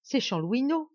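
startled exclamation at a familiar face; exclaiming. Acted. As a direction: call out in sudden recognition. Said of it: "s'exclama monsieur Lacheneur".

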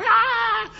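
a held high yelp like distant screaming; imitated crowd scream. Preacher imitates people screaming in the building, high restrained mock scream. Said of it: "la gente gritaba".

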